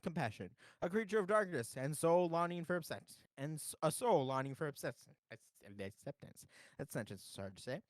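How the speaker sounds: earnest memorial speech voice; eulogy reading. Acted. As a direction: read it like a solemn eulogy. Reads a mock eulogy aloud.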